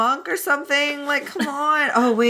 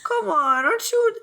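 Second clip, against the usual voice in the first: wheedling plea; 6 semitones higher, slower than usual. Acted. Guilting plea, acted Wei Wuxian.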